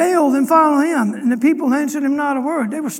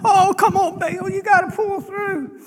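high, drawn-out plea; hammed-up desperate coaxing on a raised pitch; mock begging; 5 semitones higher than usual. Prophets jumping up and down for Baal, mock begging.

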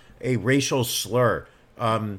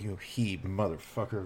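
muttered; 5 semitones lower, 12 dB quieter than usual. Retold or imitated. Muttered insult imitation.

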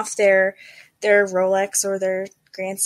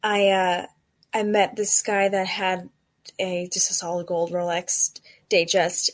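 ordinary talk

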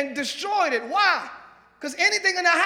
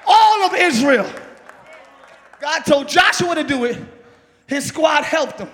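preaching at full force; 8 dB louder than usual. Acted. Preacher rallying the church to throw their stones, shouting. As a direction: whip up the congregation.

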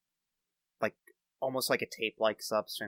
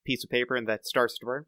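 usual speaking voice

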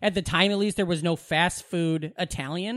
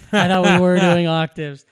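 laughing; 7 dB louder than usual. Retold or imitated. Laughs at own retold protest.

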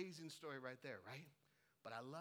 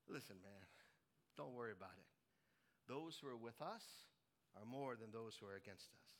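calm reassurance; 7 semitones lower, 4 dB quieter than usual. Acted. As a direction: reassure him quietly, in a low voice. Elisha calmly reassures frightened servant.

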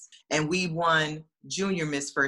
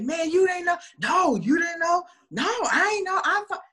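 laughing disbelief; 11 semitones higher than usual. Retold or imitated. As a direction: cry out in amused shock. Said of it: "they were laughing too".

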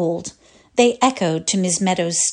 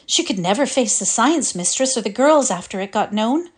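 ordinary delivery

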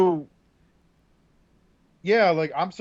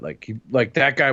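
ordinary delivery

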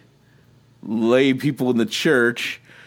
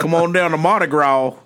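high, hammed-up Cajun Louisiana accent; mock a Louisiana local, pitched up; 6 semitones higher, 3 dB louder than usual. Podcaster joins in the skit jokes, mock Louisiana accent. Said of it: "they all do this Louisiana accent".

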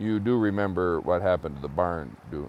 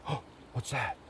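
hushed fright; 3 semitones higher, 11 dB quieter than usual. Acted. Frightened boy sees something move.

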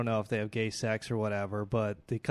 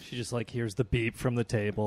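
usual speaking voice

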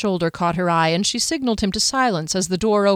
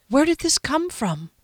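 higher voice with a touch of alarm; mildly shaken, higher-pitched question; trembling; 7 semitones higher than usual. A narrator's reading. Shopkeeper asking where the letter came from, trembling. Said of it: "Her voice trembled".

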